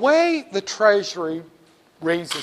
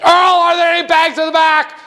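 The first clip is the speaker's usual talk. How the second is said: much higher, loud yell to the stockroom; Mabel yelling to the back; 12 semitones higher, 9 dB louder than usual. Acted. Cashier Mabel shouting to Earl in the back, hammed up. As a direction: holler for Earl in the back room. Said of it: "My name is Mabel".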